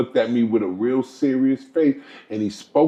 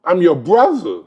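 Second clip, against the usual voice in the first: ordinary talk, nothing marked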